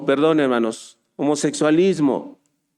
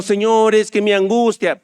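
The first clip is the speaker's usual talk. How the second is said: raised, louder imitation of a tearful plea; mock whining; 6 semitones higher, 4 dB louder than usual. Preacher mocks believers crying to God in anguish, mock whining. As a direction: mimic a crybaby pleading, pitched up. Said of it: "Ahí llorando".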